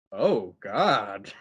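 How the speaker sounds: low, drawn-out "Oh God"; retold dismay. Oliver seeing the candlelit scene, drawn-out mock dismay. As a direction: imitate fuller-voiced dismay with swooping tune. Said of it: "And Oliver's like".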